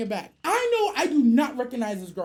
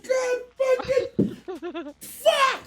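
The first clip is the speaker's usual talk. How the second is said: pained laugh; 9 semitones higher, much slower than usual. Retold or imitated. Exasperated reaction.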